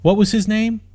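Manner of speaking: trying to recall a name; musing princess. A narrator's reading. Princess wonders aloud.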